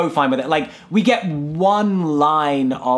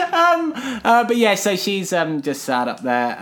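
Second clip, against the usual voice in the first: ordinary delivery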